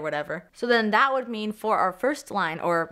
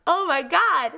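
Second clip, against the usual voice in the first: higher and louder, bursting with excitement; excited outburst; 9 semitones higher, 6 dB louder, slower than usual. Real, not acted. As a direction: let the thrill spill out. Overwhelmed by the new lineup.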